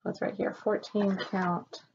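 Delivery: ordinary talk, nothing marked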